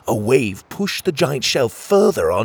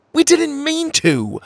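loud, much higher, slow snapped reply; barked; 6 dB louder, slower than usual. Acted. Pockets barking back at the accusing crabs, defensive. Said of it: "Pockets barked".

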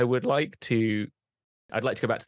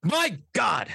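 dramatic exclamation; 11 semitones higher than usual. Acted. Player character bursts into room.

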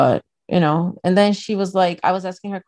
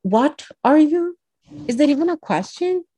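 mock baffled asker; 9 semitones higher than usual. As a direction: stretch it out high like a clueless stranger.

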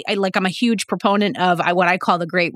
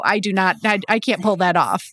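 ordinary talk

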